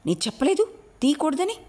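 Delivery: high, sharp indignant question. A narrator's reading. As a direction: demand an answer, outraged. Sarasi scolds servant. (కోపంగా నిలదీయడం)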